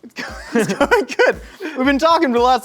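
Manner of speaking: laughing through the words; chuckling, high and giddy; giddy laughing answer to a friend. Real, not acted. Laughing while answering Jake.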